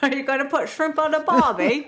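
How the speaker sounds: high, hammed-up fake Australian accent; terrible Australian accent. Host mocking co-host's accent attempt, in a terrible Australian accent. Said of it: "we're both so terrible at accents".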